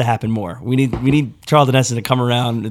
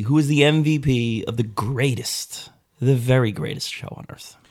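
ordinary talk

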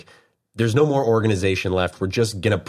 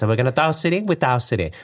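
higher-pitched, singsong Italian accent; effeminate Italian accent; 5 semitones higher, faster than usual. Shown as a demonstration. Husband redoing the mover voice higher for his wife, effeminate Italian accent. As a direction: lift the Italian voice up high and effeminate. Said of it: "it was more effeminate, though. It was, like, higher".

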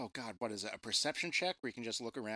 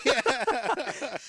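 laughing; 12 semitones higher, 9 dB louder than usual. Real, not acted. Big laugh.

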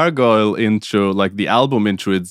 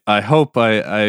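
normal talking voice

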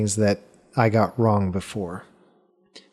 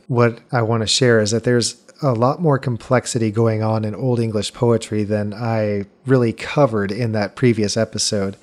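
ordinary talk, nothing marked

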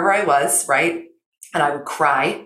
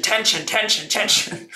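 mock tension; 8 semitones higher than usual. Mimicking the stress of chasing time.

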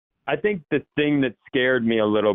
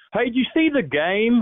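put-on much higher, thick country accent; country uncle voice; 12 semitones higher than usual. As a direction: greet like a country uncle after the game. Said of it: "I come from uncles who go".

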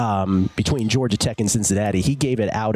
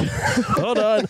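high, laughing hold on; 11 semitones higher than usual. Real, not acted. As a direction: laugh through the words. Repeats while laughing.

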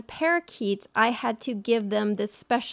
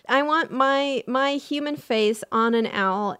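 ordinary delivery

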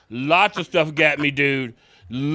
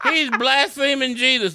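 mock outraged yell; 8 semitones higher than usual. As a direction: quote the shocked fan crying out. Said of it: "Somebody yelled out".

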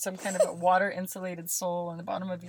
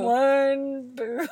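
loud, high, drawn-out finish; hammy catchphrase ending; mock slogan; 6 semitones higher, 8 dB louder than usual. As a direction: stretch it into a silly chant. Makes up a silly slogan.

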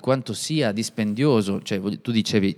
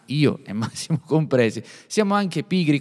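ordinary talk

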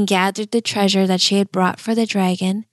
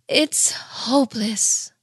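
slow, sorrowful sigh of defeat; despairing cry; 2 semitones higher, slower than usual. A narrator's reading. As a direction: say it like a queen who has given up. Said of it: "the poor queen cried".

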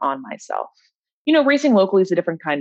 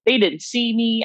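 ordinary talk, nothing marked

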